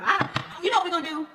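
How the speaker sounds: normal talking voice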